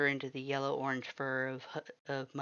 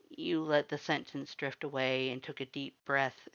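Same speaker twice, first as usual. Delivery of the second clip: plain reading voice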